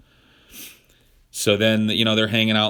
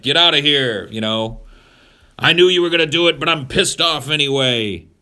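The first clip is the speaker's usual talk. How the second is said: louder, higher, fuming mock-divine rant; angry God; 4 semitones higher, 6 dB louder than usual. God throwing Adam and Eve out of the garden, loud mock fury. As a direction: catch them out, then blow up.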